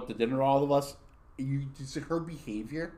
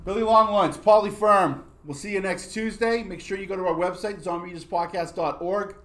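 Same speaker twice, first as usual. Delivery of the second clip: louder, higher announcer outro; podcast sign-off; 6 semitones higher, 8 dB louder than usual. Acted. Host signing off to the listeners, announcer style.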